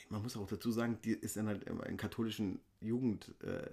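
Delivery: hushed secretive half-whisper. Real, not acted. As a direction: drop the voice as if sharing a secret. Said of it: "Wieso flüsterst du denn so?".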